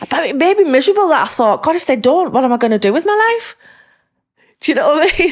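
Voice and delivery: excited. Retold or imitated. Excited retelling of childhood worry.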